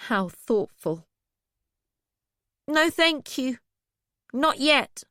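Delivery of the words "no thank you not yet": firm refusal. Acted. Bee refusing Kurf.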